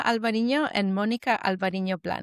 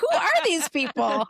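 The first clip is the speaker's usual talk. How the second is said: higher, puzzled mock voice; mock bewildered; 8 semitones higher than usual. Imitating the hosts greeting the visiting family, mock bewildered. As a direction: play the baffled hosts.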